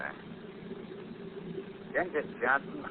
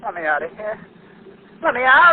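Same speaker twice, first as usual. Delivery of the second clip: high, loud frantic yell; desperate shout; 9 semitones higher, 9 dB louder than usual. Acted. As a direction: scream to be freed like a trapped animal. Trapped killer demands escape.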